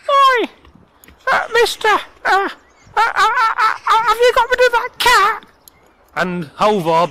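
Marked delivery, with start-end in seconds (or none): 0.1-5.5 very high, squeaky, hesitant troll voice; little tiny voice. A narrator's reading. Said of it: "he heard a little tiny voice".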